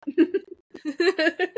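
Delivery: slow, high, stretched out; drawn-out wonder. Acted. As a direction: marvel at it theatrically. Playful echo of cohost's line.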